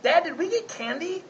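put-on high, sly kid voice; coaxing child. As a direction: wheedle for candy like a little boy. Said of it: "He'll say something like".

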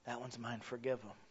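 very soft, low murmur; Jesus interceding. Acted. Jesus pleading for a sinner to his Father, hushed. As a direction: speak it low, privately to a father. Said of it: "he sits on the right hand of his Father".

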